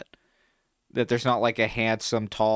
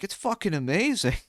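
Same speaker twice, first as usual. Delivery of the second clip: higher voice, imitating an impressed dad; dad's retold enthusiasm; 8 semitones higher than usual. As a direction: play an excited dad sharing his verdict. Voicing his dad's excited verdict.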